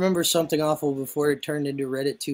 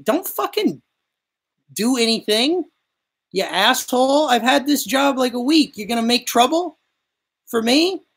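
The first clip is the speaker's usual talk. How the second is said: retold furious shout; 10 semitones higher, 6 dB louder than usual. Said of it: "And I'm like".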